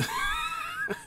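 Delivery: sharp burst of laughter; sudden high laugh. Real, not acted. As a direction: squeal of laughter at yourself. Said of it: "Oh, I'm so dumb".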